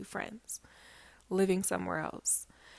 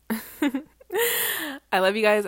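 laughing love; 7 semitones higher, 7 dB louder, much slower than usual. Real, not acted. Laughing, emotional sign-off.

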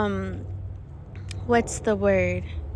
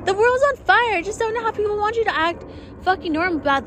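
high, fast and frantic; a frantic rant, much higher and faster; exasperated rant; 9 semitones higher, faster than usual. Retold or imitated. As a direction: rattle it off like it's all too much. Exasperated rant about the world.

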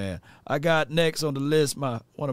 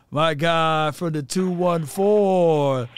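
loud, drawn-out build to a high pitch; big announcer-style welcome, rising as it goes; hyped caller introduction; 5 semitones higher, 5 dB louder, slower than usual. Real, not acted. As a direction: hype up the next caller. Host introduces the next caller.

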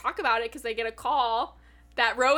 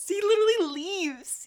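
high, indignant cry; outraged fangirl complaint; exasperated disbelief; 9 semitones higher, 4 dB louder than usual. Real, not acted. Exasperated retelling of departure.

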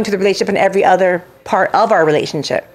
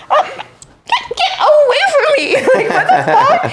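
high, laughing brush-off; retelling it through laughter; laughing protest. Retold or imitated. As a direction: push him away while cracking up. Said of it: "I was like".

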